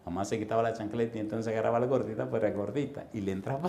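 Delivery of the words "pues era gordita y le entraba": ordinary talk, nothing marked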